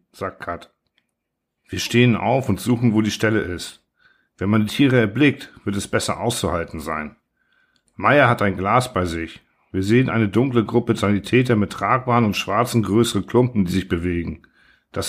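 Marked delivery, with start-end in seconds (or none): none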